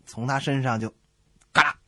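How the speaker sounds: ordinary talk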